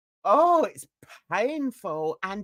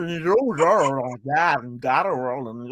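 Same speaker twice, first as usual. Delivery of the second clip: mocked Southern accent; 9 semitones lower than usual. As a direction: imitate the accent in a low, rolling mumble. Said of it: "I can't get past the accent".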